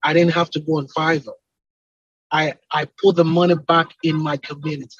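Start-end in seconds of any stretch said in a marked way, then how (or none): none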